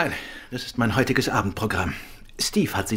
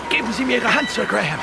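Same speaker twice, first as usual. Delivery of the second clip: straining call; 12 semitones higher than usual. Acted. Reaching to pull man aboard.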